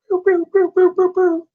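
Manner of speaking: very high cartoon boo-hoo; squeaky string of high crying sounds; imitated cartoon crying. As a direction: mimic tears spurting in a cartoon, high-pitched. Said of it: "these cartoons when they cry".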